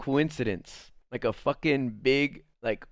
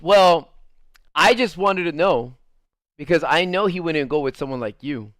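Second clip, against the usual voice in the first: raised, louder comeback easing off; retold confrontation; 4 semitones higher, 7 dB louder than usual. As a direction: imitate her challenging a rival. Retold quarrel between two women.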